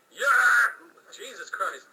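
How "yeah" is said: loud, high yell; loud boastful yes; cocky shout. Acted. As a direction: belt it out proudly. Jack boasting loudly.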